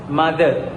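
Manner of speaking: "mother" with a hard D for the TH; Filipino pronunciation. Shown as a demonstration. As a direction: say "mother" as "ma-der". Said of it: "In Filipino language".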